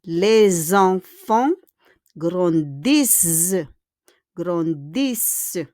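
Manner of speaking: "Les enfants" joined by a clear Z, slowly; liaison with Z. Shown as a demonstration. Teacher repeats the example after explaining liaison, S as Z. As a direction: draw out "Les enfants grandissent", saying lez-enfants. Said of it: "We need to pronounce it po sa Z".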